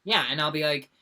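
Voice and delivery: ordinary delivery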